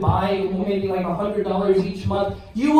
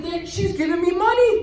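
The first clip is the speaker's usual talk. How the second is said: put-on excited kid; 8 semitones higher, faster than usual. Kid discovering he got paid.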